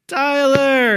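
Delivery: loud, sharp call of a name; startled call. Retold or imitated. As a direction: shout his name as the punch comes. Calling out as friend approaches.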